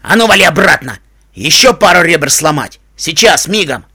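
loud, menacing orders; bullying, full-force yelling; angry shout. A narrator's reading. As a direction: yell threats to drive someone back. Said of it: "крикнул лощёный жеребец".

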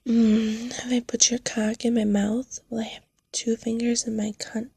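breathy close-mic whisper. Acted.

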